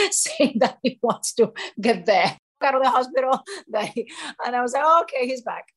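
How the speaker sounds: talking through laughter, voice lifted; laughing. Real, not acted. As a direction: let laughs break up the words. Laughing at dad's return to grumpiness.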